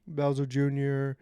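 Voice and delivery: ordinary talk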